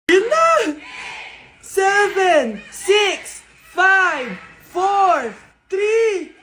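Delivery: high, very loud shouted countdown; excited countdown. Acted. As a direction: shout it out for a whole crowd. New Year countdown. (pasigaw na pagbibilang)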